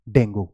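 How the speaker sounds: dengue as "DENG-goo"; the mistaken dengue with a "goo" ending; wrong pronunciation. Shown as a demonstration. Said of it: "please don't say".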